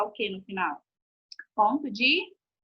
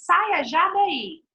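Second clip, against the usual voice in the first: "Saia já daí" slow, higher and louder as a command; firm order; 6 semitones higher, 5 dB louder, much slower than usual. Shown as a demonstration. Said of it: "é uma ordem". (ordem firme e enfática)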